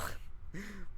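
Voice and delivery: short break-up laugh; breathy giggle. Real, not acted. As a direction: giggle at yourself mid-scene.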